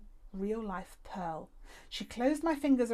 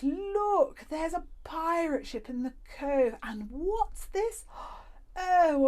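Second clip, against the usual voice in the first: higher, louder, slow and rapturous; thrilled old lady; 8 semitones higher, 6 dB louder, slower than usual. A narrator's reading. The old lady delighting in the make-believe cove, higher and rapturous. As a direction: gush over every wonder. Madame Badobadar in paradise.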